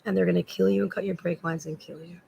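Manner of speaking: normal talking voice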